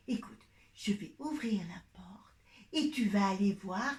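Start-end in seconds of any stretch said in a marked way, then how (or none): 0.1-2.0 soft hushed whisper; chuchoté. Acted. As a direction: whisper it like a secret. Secret magic door to child. (voix chuchotée)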